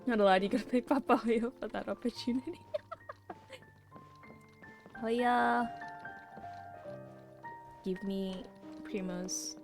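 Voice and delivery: usual speaking voice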